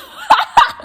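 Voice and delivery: high, loud laugh through tears; a sudden high laugh breaking out; real burst of laughter. Real, not acted. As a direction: laugh at yourself, embarrassed. Laughs at her own crying.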